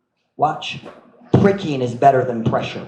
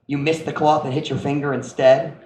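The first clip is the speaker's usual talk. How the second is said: ordinary talk, nothing marked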